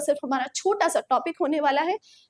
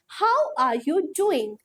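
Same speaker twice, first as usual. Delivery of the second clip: each word spaced out, slow and clear; slow model sentences; much slower than usual. Shown as a demonstration. Teacher modelling phrases for students, slow and clear. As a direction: read it slowly so students can copy. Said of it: "teacher models sentence slowly".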